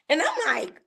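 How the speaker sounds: usual speaking voice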